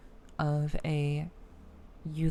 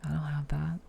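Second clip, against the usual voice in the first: whispering; 3 dB quieter than usual. Real, not acted. Whispered aside.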